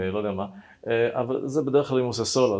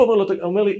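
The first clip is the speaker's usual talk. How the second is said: normal talking voice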